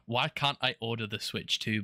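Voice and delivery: ordinary talk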